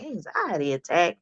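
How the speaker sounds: soft, stunned question; barely voiced shock; quiet disbelief. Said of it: "I'm like".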